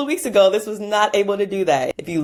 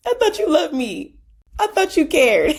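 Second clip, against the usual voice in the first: high, pleading movie-scene imitation; a playful high mock of a heartbroken girlfriend; imitating a movie breakup line; 11 semitones higher than usual. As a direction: echo the hurt girlfriend from the film, pitched way up. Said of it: "the little scene where Rashad snatches Nunu's necklace".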